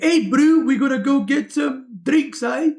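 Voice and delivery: loud, high, rough South African impression; hammed-up South African accent, calling to a mate. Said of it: "I can't do their accent properly".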